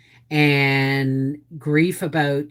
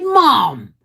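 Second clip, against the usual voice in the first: high, whiny grown son brushing it off; mimicking son protesting; 13 semitones higher, 4 dB louder than usual. Son telling mom it was only a little cut, high whine. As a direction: pitch it up like a son downplaying it. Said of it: "He said".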